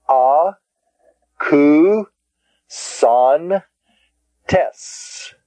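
"A, ku, son, tes" as four separate syllables; long gaps between "A", "ku", "son" and "tes". Shown as a demonstration. Teacher dividing the word "A, ku, son, tes", syllable by syllable.

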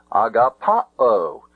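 slow "agapao" with PA stressed. Shown as a demonstration. Teacher shows how the word is pronounced, "agapao" stressed on PA. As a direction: hit the pa in "agapao" and draw it out. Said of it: "The word is pronounced".

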